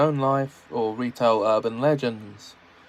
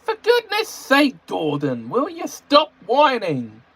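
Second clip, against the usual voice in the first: high, loud exasperated snapping; angry James; 11 semitones higher, 4 dB louder than usual. Acted. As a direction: scold the whiner sharply. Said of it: "said James angrily".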